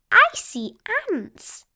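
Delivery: put-on slow, high curious child voice; Peppa's voice. Peppa looking down at ants, slow curious. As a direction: point it out slowly, fascinated. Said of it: "she says".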